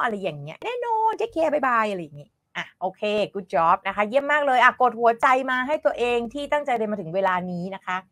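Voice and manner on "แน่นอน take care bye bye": high, sing-song sign-off; cheery acted goodbye. Teacher playing the friend agreeing and saying bye, high and cheery. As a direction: draw out the farewell in a raised pitch.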